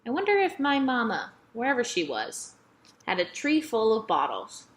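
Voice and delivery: plain reading voice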